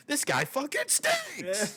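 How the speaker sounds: high, drawn-out jeer; playful high-pitched booing fan; mock heckler. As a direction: heckle the judge in a squawking put-on voice. Acted heckling after booing the judge.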